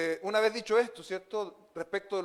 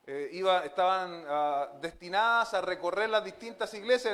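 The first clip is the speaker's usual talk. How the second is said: usual speaking voice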